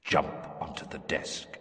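breathy far-off whisper; whispered command. Acted. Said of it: "voice echoing in some distant chamber".

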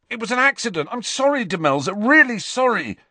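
high-pitched shout after someone; apologetic shout. A narrator's reading. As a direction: call the apology out high and urgent. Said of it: "Ron shouted after her".

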